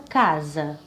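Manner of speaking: "casa" drawn out on the first syllable; stress on first syllable. Shown as a demonstration. Teacher showing pupils the strong syllable, stressed "casa". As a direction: lean on the "ca" of "casa". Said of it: "a sílaba mais forte seria".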